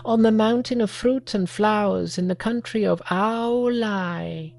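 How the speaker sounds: high, odd little monkey voice. Acted. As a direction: speak as an eager young monkey.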